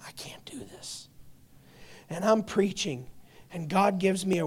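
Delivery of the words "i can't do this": a breathless whispered plea; whispered prayer. Retold or imitated. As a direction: whisper it as if at the end of your strength. Retold desperate prayer, whispered.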